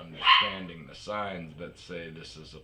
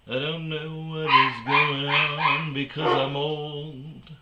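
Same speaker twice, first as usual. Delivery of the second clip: put-on high, slow doddering old man voice; lightly hammed-up clueless old geezer; mock old man; 8 semitones higher, 5 dB louder, slower than usual. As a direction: play a confused old man, drawn out. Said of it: "that is an old man".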